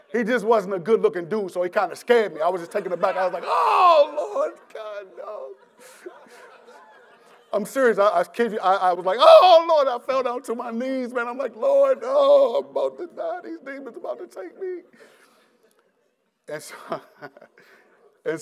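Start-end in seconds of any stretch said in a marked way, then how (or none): 3.5-5.5 high, loud panicked wail; retold terrified outcry at the door; terrified cry. Terrified by demonic-looking man. 9.2-9.9 high, loud anguished cry; retold cry falling to his knees; fearful cry. Falls to knees in fear. 11.6-14.8 high, shouting terrified plea; retold shout of terror at demons; panicked cry. Believes demons will take him.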